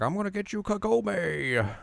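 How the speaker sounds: high, drawn-out villain threat; mock menace. Tree monster threatening Kagome, mock menace. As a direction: taunt in a hammed-up high voice. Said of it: "And then he's like".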